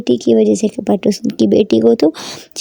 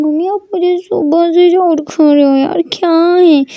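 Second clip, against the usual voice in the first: whiny daughter; 6 semitones higher, slower than usual. Acted. Daughter complaining.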